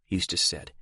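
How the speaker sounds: usual speaking voice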